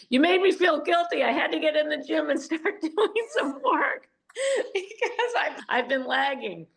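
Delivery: high, laughing mock confession; guilty football guy laughing. Football guy admits guilt to her, laughing sheepishly. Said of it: "he goes".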